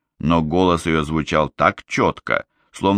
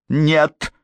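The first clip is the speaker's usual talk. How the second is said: curt refusal; 10 semitones higher than usual. Acted. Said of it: "отрезала Гламора".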